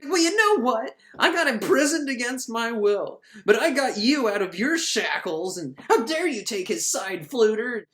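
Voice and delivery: high, indignant mock princess rant; upset princess. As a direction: scold him like an offended princess. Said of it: "She's gets a little upset".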